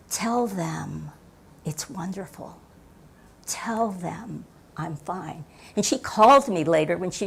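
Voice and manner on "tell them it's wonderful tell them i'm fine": soft, hushed voice. Retold or imitated. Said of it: "this voice came and said".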